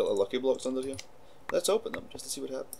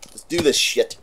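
hyped; 4 semitones higher, 9 dB louder than usual. Real, not acted. Hyped start of round.

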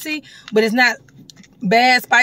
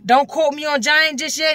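ordinary talk

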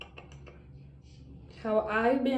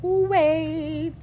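excited; 8 semitones higher, 7 dB louder than usual. Real, not acted. Excited about full shopping carts.

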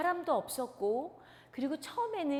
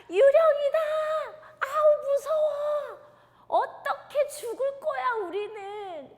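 much higher, loud panicked cry; terrified shriek; 13 semitones higher, 8 dB louder than usual. Acted. Disciples crying out at the ghost, terrified. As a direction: scream at the ghost.

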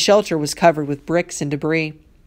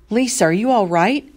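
high, urgent call, moderately raised; alarmed yell; 5 semitones higher than usual. A narrator's reading. Boys rushing in to check on Lisa, alarmed yell. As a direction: call out with worry in a raised pitch. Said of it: "they yelled in unison".

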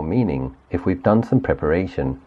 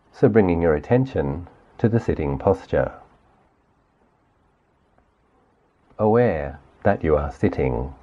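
guided meditation. Acted. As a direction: gently lead the listener through the body, unhurried. Leads guided body sweep meditation.

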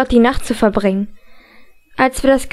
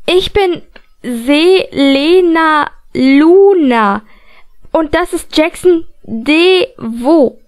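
higher, louder, very slow, every syllable spelled out; loud and overly clear; 3 semitones higher, much slower than usual. A narrator's reading. Selena introducing herself to a confused Matthew, loud and over-enunciated. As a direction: speak as if to someone slow of mind. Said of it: "Laut und übertrieben deutlich sagte Selena".

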